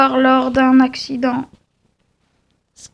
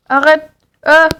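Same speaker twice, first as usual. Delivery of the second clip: usual speaking voice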